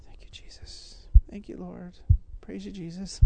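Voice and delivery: breathy whispered thanks to Jesus; preacher pausing to whisper a private prayer of thanks. Real, not acted. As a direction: whisper the thanks under your breath, very soft. Quiet prayer in the sermon.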